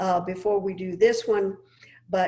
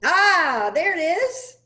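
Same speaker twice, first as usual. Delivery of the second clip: high, loud burst of delight; delighted relief; 9 semitones higher, 8 dB louder, slower than usual. Real, not acted. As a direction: exclaim as it finally appears, much higher and louder. Screen finally shows the picture.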